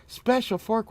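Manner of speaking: high, baffled question; mock disbelief at a special fork; incredulous. Retold reaction to waitress.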